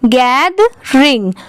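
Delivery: Gathering slowly, split as gaid, then ring; teacher's slow two-part gathering, gaid-ring; divided into syllables. Shown as a demonstration. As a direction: break gathering into two drawn-out parts. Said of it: "ऐसे divide करके पढ़ेंगे".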